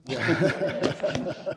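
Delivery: short embarrassed laugh; sheepish laugh. Real, not acted. Resident laughs at the chair's joke, sheepish laugh. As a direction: laugh it off, caught out.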